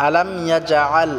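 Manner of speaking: يجعل with a firm j and squeezed ayn; careful correct demo of يجعل; hard jim, throaty ayn. Shown as a demonstration. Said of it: "জিমটাকে শক্ত করে উচ্চারণ করতে হবে".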